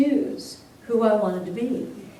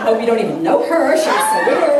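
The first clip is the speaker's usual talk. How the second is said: eye-rolling disdain; 6 dB louder than usual. Retold or imitated. Cool girls disowning her to others, eye-rolling disdain. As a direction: imitate scornful schoolgirls, voice rising. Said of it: "rolling their eyes going".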